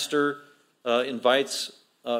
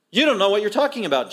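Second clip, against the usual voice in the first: indignant, higher-pitched comeback; defiant retort; 7 semitones higher, 4 dB louder, faster than usual. Retold or imitated. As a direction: push back as the offended crowd. Acting out defiant reply to Jesus.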